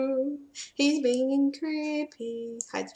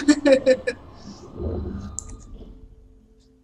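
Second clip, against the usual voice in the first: high laugh; 4 dB louder than usual. Real, not acted. Laughs at own joke.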